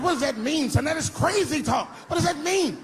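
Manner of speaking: high shouted outrage; father yelling at son in principal's office, high outrage; shocked yelling father. Retold or imitated. Frantic high dad shout.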